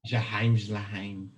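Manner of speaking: slow made-up German with mock harsh Australian-free German sound. Mocking German after the honeymoon talk, slow fake German. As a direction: spit out fake German gibberish slowly. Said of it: "everything they say be sounding mad".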